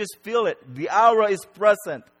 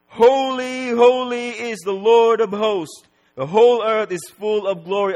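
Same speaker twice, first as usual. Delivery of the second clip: crying out; 5 semitones higher, 7 dB louder than usual. Acted. Seraph crying out in praise before God, crying out. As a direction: cry it out to shake the gates. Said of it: "cried out".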